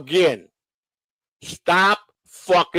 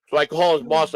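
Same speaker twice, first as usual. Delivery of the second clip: ordinary talk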